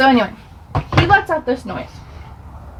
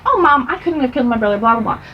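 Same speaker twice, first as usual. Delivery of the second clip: dismissive rush; faster than usual. Retold or imitated. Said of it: "I'm like".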